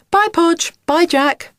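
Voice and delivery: high, loud cheery farewell call; called-out goodbye. A narrator's reading. Miss Whiz to the children as she drives off, calling out. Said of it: "she called out".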